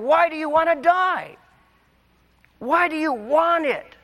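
high, pleading cry of God; tearful plea. Acted. Preacher voicing God's tearful plea to Israel. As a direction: cry it out with a tear in the voice. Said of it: "the Lord's voice with a tear".

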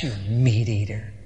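very low, soft mock contempt; contemptuous parting shot. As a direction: mutter it as a parting insult. Krishna's parting insult.

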